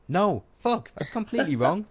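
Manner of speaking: exasperated with own mistake; frustrated outburst. Real, not acted. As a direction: swear at yourself for botching it. Botches impression, swears at himself.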